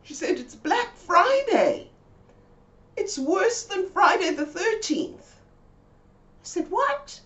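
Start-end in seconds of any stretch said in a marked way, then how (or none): 0.4-5.3 high, slow tragic voice of the friend; high slow doom-laden Mabel; dramatic friend. Retold or imitated. 6.7-7.2 very high incredulous squeak; disbelieving high what; shocked what. Retold or imitated. Shocked reply.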